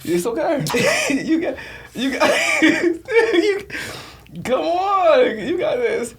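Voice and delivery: laughing while cheering him on; giggling through the encouragement. Real, not acted. Laughing at guest's burning mouth.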